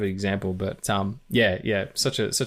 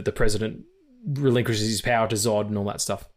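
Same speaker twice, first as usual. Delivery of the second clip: ordinary talk, nothing marked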